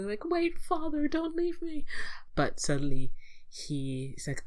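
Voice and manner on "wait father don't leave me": very high, pleading imitation; mock calling out. Simba calling out to his departing father, mock plea. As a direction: call after him in an exaggerated high voice. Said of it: "calling out to his father".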